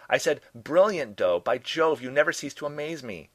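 high, loud admiring holler; yelling back. A narrator's reading. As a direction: shout lavish praise from another room. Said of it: "Furman yelled back".

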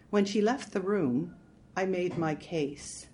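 plain reading voice

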